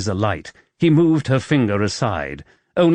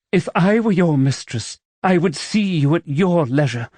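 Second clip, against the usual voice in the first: higher heroine voice, bitter; woman's voice; 7 semitones higher than usual. Acted. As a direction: voice her, worldly and resigned.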